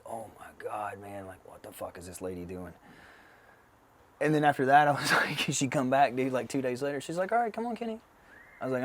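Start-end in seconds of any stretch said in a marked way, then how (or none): 0.0-2.7 low, hushed disbelief; retold quiet bewilderment; stunned murmur. Retelling stunned aftermath of therapy. 7.3-8.0 high-pitched imitation of the therapist; mock therapist summons, pitched up; therapist beckoning.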